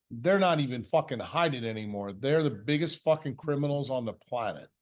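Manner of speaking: ordinary talk, nothing marked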